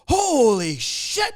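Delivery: loud, high, drawn-out mock shock; startled shout. Guest startled by host walking into kitchen, imitated startled shout.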